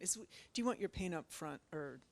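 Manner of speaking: ordinary talk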